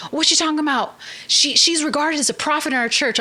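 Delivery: scandalized friend pitched high; shocked friend. Retold or imitated. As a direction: gasp and defend the prophet.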